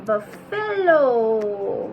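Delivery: buffalo drawn out slowly; slow model word. Shown as a demonstration. Teacher saying buffalo slowly for children to repeat. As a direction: stretch buffalo out for the class. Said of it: "Say it with me".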